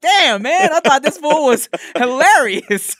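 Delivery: loud, high-pitched comic letdown; mock dismay. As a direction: exclaim it loud and high, as if hurt. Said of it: "being hurt almost".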